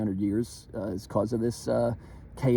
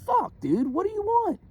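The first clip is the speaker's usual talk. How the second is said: pitched way up, exasperated, almost squealing; exasperated high outburst. Retold or imitated. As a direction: throw up your hands in a high, incredulous voice. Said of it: "And I'll be like".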